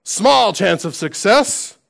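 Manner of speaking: rally the troops for a doomed charge; Gimli. Retold or imitated. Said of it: "Gimli's remark on Return of the King says".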